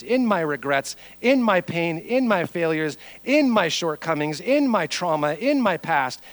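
chanting list, each phrase starting high and falling; building sermon litany. Real, not acted. Preacher listing where God works, rhythmic and building. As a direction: drive the repeated phrases steadily upward in pitch.

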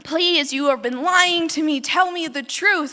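mock pleading. Delilah begging Samson for his secret, mock pleading. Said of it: "she pleads to him and says".

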